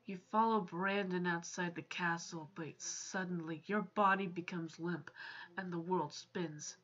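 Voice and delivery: low, hushed storytelling read; quiet, low-pitched game narration; story narration. Acted. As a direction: read the scene aloud, soft and low. Reads the game's story aloud.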